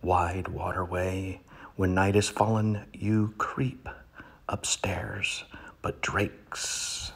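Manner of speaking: dramatic poetry reading with sudden swells; poem reading. Acted. Comic reader performing a poem to his audience, hammed up. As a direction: reads the duck poem with grand pauses.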